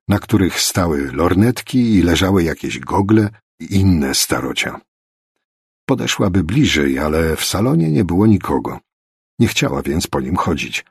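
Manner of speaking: usual speaking voice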